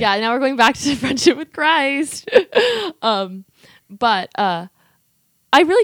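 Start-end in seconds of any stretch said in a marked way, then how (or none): 2.3-3.0 high laugh. Real, not acted. Laughs after returning to topic.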